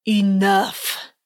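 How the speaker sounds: lightly voiced stern old judge; a hint of a gruff judge calling order; gravelly judge. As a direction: cut the hall off like a fed-up judge. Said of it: "cried one of the judges in a gravelly voice".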